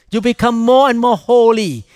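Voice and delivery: usual speaking voice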